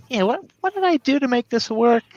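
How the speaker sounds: high, puzzled mock voice; asking in high confusion; confused self-quote. Imagined confused developer quote.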